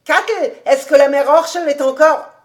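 high, loud call to someone far off; calling down the hallway. A narrator's reading. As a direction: shout out a question. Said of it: "en criant dans l'allée".

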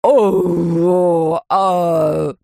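long, loud moaning wail; stretched wail of pain; howling in pain. A narrator's reading. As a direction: draw out an agonised "oh... ah". Dentist howling, finger bitten.

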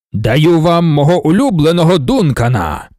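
ringing declamation of a ruler; grand proclamation. A narrator's reading. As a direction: announce a gift to a whole hall of followers. God-emperor announces gift to crowd.

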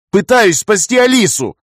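loud, high, drawn-out desperate retort; frantic, forceful shout; shouting back. A narrator's reading. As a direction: yell back in self-defence. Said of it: "крикнул я в ответ".

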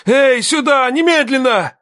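very high, desperate shout; yelling for help. A narrator's reading. Man calls help to the bleeding girl, desperate yell. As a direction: yell at the top of the voice. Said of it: "заорал так громко, что сорвал голос".

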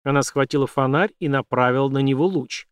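ordinary delivery, nothing marked

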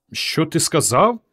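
slow, rising disbelief; stunned question. A narrator's reading. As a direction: ask again slowly, pitch climbing at the end. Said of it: "вражено перепитала дівчина".

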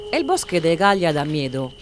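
ordinary delivery

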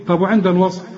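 ordinary talk

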